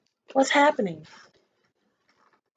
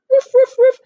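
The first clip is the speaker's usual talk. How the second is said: higher, louder playful woofs; barking dog; 12 semitones higher, 10 dB louder than usual. A narrator's reading. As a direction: bark it like a happy dog. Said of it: "barked Daisy".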